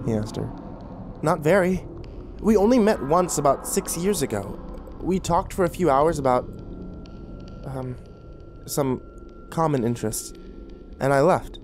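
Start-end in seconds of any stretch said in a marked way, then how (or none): 1.2-11.6 higher voice with halting pauses; hesitant. A narrator's reading. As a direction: start answering, then falter and grope for words. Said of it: "she told him hesitantly".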